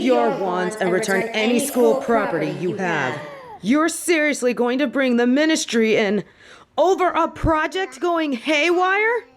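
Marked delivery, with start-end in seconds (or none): 3.6-9.3 outraged protest. Acted. Protesting the ministry threat.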